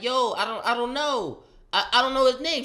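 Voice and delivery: high, loud panicked protest. Retold or imitated. Son protesting to mom he doesn't know the boy. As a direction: plead your case, rising up.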